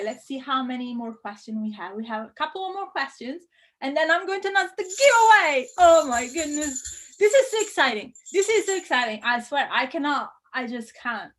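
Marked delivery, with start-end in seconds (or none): 5.8-9.2 excited. Real, not acted. Teacher before announcing giveaway, excited gushing. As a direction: bubble over with excitement. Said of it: "I'm getting very excited".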